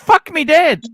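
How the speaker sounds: high, loud amazement; astonished outburst. Real, not acted. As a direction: blurt it out, floored by the news. Amazed at the friend's expensive watch.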